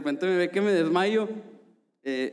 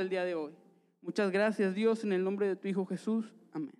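earnest prayer, slightly higher; 4 semitones higher than usual. Acted. Leads the congregation in prayer.

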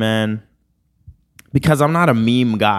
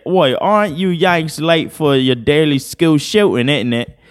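mock British accent; 5 semitones higher than usual. British person jeering at Yanks, mock British accent. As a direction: taunt in a hammed-up British accent. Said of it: "British people".

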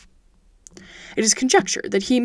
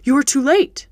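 louder and higher, urgent dismay; alarmed exclamation; 5 semitones higher, 5 dB louder than usual. Acted. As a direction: blurt out the bad news in alarm. Watson alarmed at the news.

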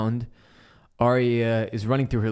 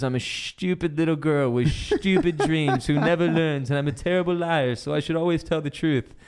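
higher-pitched mock little-girl confession; Sansa; 5 semitones higher than usual. Niece protests to her aunt, high mock-earnest imitation. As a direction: imitate a girl reciting her faults, leaning on "stupid". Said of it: "I love how she says stupid".

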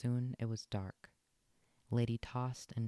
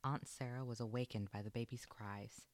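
usual speaking voice

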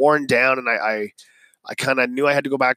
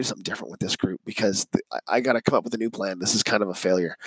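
lower, softer, fast self-talk; muttered aside to self; 5 semitones lower, 5 dB quieter, faster than usual. Retold or imitated. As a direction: grumble a quick change of plan under your breath. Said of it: "I said".

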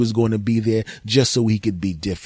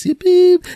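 squeaky beeping like an answering machine; high loud beep imitation; 10 dB louder than usual. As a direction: make the machine's beep sound.

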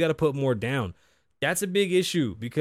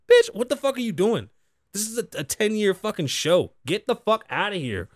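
outraged rant; 7 semitones higher than usual. Real, not acted. Ranting at the guest on his show, outraged. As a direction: let the outrage spill out.

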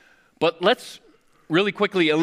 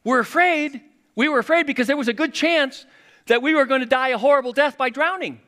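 much higher, louder defensive disciples; disciples answering; 13 semitones higher, 6 dB louder than usual. Retold or imitated. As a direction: voice the disciples protesting, high and loud. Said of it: "They would have said something like".

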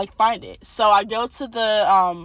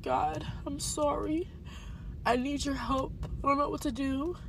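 soft, broken plea to God, higher and softer; tearful prayer; 5 semitones higher, 9 dB quieter than usual. Retold or imitated. Retelling a weeping prayer to God, hushed and tearful. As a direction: pray through the tears, barely voiced. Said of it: "I'm weeping, and I'm crying out to God".

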